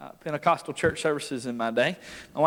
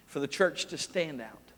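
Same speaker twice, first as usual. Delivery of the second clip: normal talking voice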